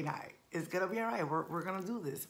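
normal talking voice

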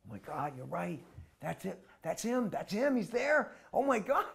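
from hushed to high and loud; building from a murmur to excited; rising awe. Retold or imitated. Boy recognizes Prabhupada, awestruck.

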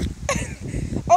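high giggle. Real, not acted. Laughing after vinegar.

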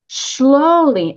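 emphasize slowly; stress on slowly. Shown as a demonstration. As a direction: lean hard on slowly. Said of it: "I emphasize the word slowly".

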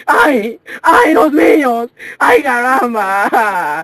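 slow, drawn-out Spanish wail; mock Spanish lament. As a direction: ham up a dying Spanish cry.

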